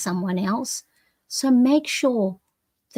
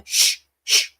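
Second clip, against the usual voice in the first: hushing shh. Shown as a demonstration. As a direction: hush the doubts away. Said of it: "sometimes you just go".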